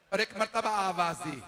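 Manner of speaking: ordinary talk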